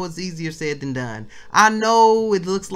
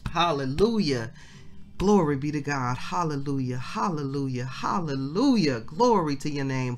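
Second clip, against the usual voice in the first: softer, lower murmured praise; quiet worshipful prayer; 4 semitones lower, 4 dB quieter than usual. Acted. Preacher quietly thanking the Father after the sermon, soft and low. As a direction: sink into private thanks to God.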